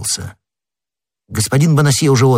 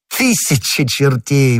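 exclaiming; 5 semitones higher, slower than usual. A narrator's reading. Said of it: "воскликнул Д'Артаньян".

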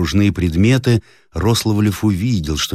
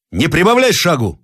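shouting; 10 semitones higher than usual. Acted. Captain to nervous soldiers, high shout. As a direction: shout down the hurry. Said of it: "закричал Зарядьев".

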